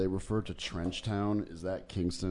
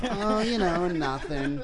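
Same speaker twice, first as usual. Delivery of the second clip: higher, breezy shrug-off; casual brush-off voice; 9 semitones higher, 5 dB louder, slower than usual. Retold or imitated. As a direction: act like it was nothing. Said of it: "I'm like".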